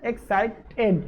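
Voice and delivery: एक्साइटेड pulled apart as ex, ci, ted; एक्साइटेड said syllable by syllable with small gaps; split into syllables. Shown as a demonstration. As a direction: break एक्साइटेड into its three syllables. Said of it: "तीन syllable में तोड़ सकते हैं".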